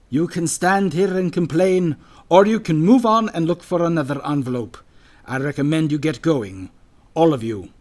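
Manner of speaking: loud, no-nonsense order to a crowd; brisk interruption. A narrator's reading. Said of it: "Brisk cut across him".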